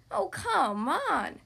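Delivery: slow, drawn-out exasperated groan. A narrator's reading. Squirrel delayed by stuck Beaver, groaning. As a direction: groan it, stretching every word. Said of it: "Squirrel groaned".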